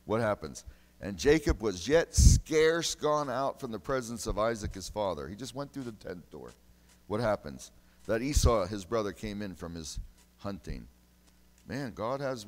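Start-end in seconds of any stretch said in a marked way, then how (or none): 1.0-5.3 louder, full-force preacher reading; preaching scripture. Acted. Loud scripture reading in sermon. 8.0-10.9 louder, full-force preacher reading; preaching scripture. Acted. Loud scripture reading in sermon.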